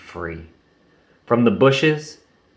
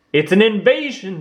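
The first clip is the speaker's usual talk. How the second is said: higher, bouncy alarm call; mock shout; 10 semitones higher than usual. Tigger warns the gang, higher mock shout. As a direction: sound the alarm, half playful. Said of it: "shouted Tigger".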